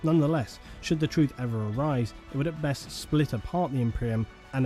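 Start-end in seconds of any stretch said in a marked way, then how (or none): none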